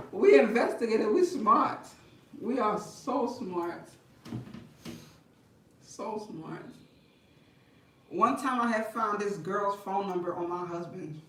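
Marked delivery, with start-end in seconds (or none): none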